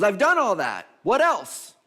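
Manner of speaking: loud, high, insistent retort; impatient questioner. Retold or imitated. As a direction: push back loudly with pitch jumping up.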